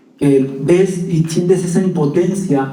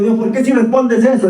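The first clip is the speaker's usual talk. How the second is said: higher plea to God; 6 semitones higher than usual. Retold or imitated. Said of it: "yo digo".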